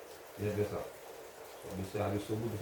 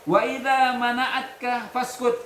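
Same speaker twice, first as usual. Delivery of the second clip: slow, loud, higher reading chant; Arabic recitation; 12 semitones higher, 8 dB louder, slower than usual. Acted. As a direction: intone the Arabic text slowly for the class. Teacher reads Arabic text aloud.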